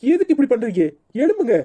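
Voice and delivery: high, urgent cries of alarm; rising panic, pitch climbing at the end; alarmed pleading. Acted. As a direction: beg him to get up, panicked. Daughter-in-law finds collapsed father-in-law.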